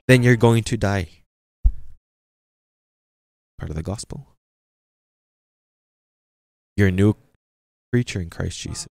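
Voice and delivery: rising sermon declamation; preaching. Acted. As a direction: proclaim it to the congregation. Preaching to congregation.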